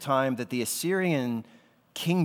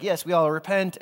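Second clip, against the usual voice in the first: mock eager repentance; 6 semitones higher, 5 dB louder than usual. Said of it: "they're like".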